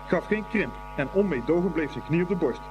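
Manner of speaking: ordinary delivery, nothing marked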